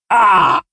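the baron cut off by a sting; pained gasp. Acted. Baron crying out as Alia's needle stings him, sharp gasp. Baron stabbed mid-sentence.

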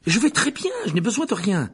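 put-on high, loud boyish objection; indignant protest. A narrator's reading. Embarrassed boy refusing help from the nurse, indignant. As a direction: protest hotly, voice jumping up.

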